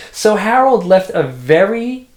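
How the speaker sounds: words bubbling with real laughter; laughing while reading. Real, not acted. Reading to her child, laughing through the line. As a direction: read through a giggle.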